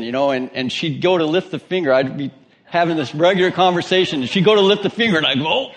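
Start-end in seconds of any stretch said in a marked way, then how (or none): 5.5-5.7 high-pitched, jokey panic; mock alarm. Pastor to Sheila as she lifts her finger, comic alarm. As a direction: duck away from a loaded finger, hammed up.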